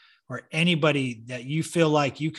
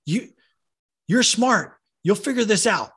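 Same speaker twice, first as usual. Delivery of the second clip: imitated coach, raised pitch and punchy volume; tough-love coach telling off a player; loud, high, emphatic retelling of a coach's push; blunt encouragement; 6 semitones higher, 4 dB louder than usual.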